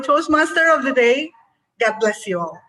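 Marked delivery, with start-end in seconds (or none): none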